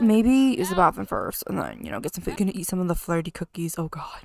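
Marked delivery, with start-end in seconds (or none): 1.1-4.2 soft whisper under the breath; whispered aside. Real, not acted. Player whispering to viewers about her sims. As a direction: whisper it so the sims won't hear.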